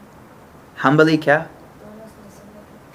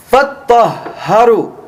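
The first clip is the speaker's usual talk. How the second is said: فَطَّهَّرُوا with heavy doubled consonants, higher and quick; emphatic Arabic; 4 semitones higher, faster than usual. Shown as a demonstration. Teacher shows students Quranic emphasis, firm stress. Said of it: "This word is in a form of emphasis".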